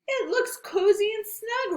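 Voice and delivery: high bouncy rabbit voice; a cheerful little bunny. Acted.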